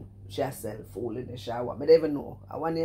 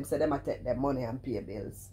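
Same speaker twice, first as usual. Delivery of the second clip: usual speaking voice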